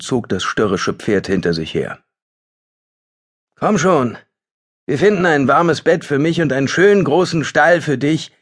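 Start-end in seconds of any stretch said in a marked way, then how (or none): 3.6-8.3 higher, grinning forced joke; forced cheer. Acted. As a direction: cheer up the horse against despair. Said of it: "Er grinste, als hätte er einen guten Witz gemacht".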